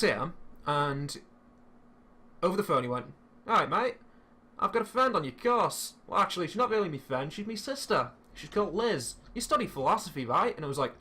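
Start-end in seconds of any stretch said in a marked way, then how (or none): 3.5-10.5 higher-pitched bad Australian accent, sounding Scouse; botched Australian accent. Prank caller chats up a student by phone, botched Australian accent. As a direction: imitate an Australian, landing near Liverpool. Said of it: "sound like you're doing a Liverpool accent".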